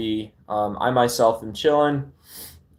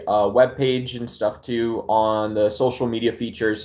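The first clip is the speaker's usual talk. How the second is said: ordinary delivery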